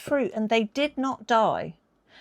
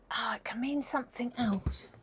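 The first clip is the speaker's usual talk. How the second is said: soft unvoiced whisper; whispered aside; 2 semitones higher, 11 dB quieter than usual. Real, not acted. Half to herself while recalling the word, in a whisper.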